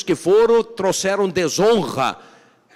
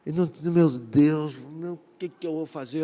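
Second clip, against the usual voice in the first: a despairing moan fading to near silence; lamenting leader; 5 dB quieter than usual. Acted. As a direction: groan it like a broken man, face in the dirt. (lamento desesperado dos líderes, voz sumindo)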